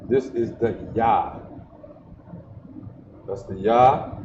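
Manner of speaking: ordinary delivery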